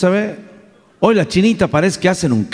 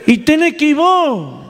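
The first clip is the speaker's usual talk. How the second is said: much higher, slow insisting tone; mock insistence; 10 semitones higher than usual. Daughter telling her father he has to go, high and insistent.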